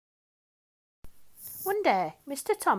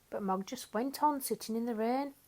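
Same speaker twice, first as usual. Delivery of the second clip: usual speaking voice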